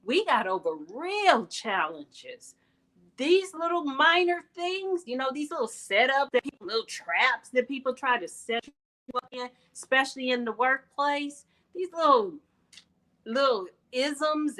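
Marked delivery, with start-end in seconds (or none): 3.2-14.6 rousing. Real, not acted. Preaching to ladies, building.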